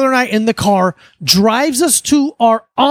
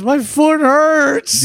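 slow, drawn-out little whine; whiny hurt brother; 4 semitones higher, much slower than usual. Retold or imitated. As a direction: whine it slowly, milking the pain. Brother answering in pain.

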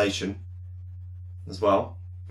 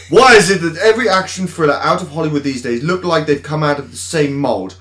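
loud, indignant upper-class rant; angry posh voice; 2 semitones higher, 3 dB louder than usual. Acted. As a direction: rant like an outraged toff critic. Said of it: "So angry posh voice".

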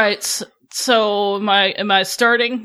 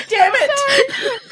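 high laughing shout; laughing yell. Real, not acted. Author breaking up while reading, laughing yell. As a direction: shout it through giggles.

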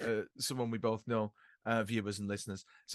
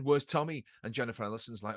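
normal talking voice

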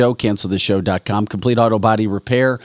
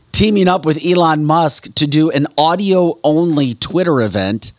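ordinary talk

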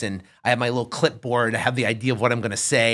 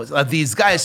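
usual speaking voice

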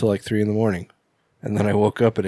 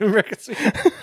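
high mocking repeat; mock high echo; 11 semitones higher than usual. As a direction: squeak it back high. Echoes nickname in mock voice.

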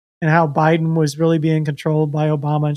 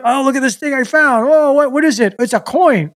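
mock treasure hunter; 6 semitones higher than usual. Imagines digging as treasure hunter.

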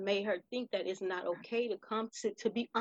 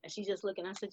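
ordinary talk

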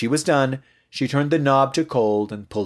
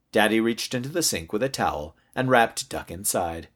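plain reading voice

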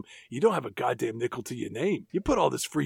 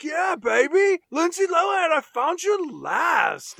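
high, loud mock swagger, slowing at the end; hammed-up Tad; 12 semitones higher, 3 dB louder, slower than usual. Tad bursting in to claim Lindsay Lohan, high hammed-up crowing. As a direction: crow it like a cocky boyfriend. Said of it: "Tad's there and he's like".

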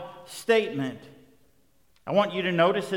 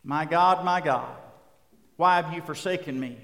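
ordinary delivery

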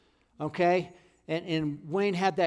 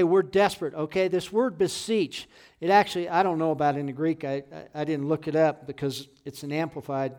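normal talking voice

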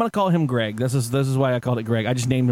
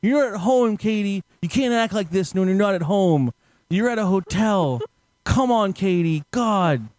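raised-pitch exasperated pleading; imitated frustration; 6 semitones higher than usual. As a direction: play a fed-up man scolding his companion. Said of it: "he starts getting frustrated".

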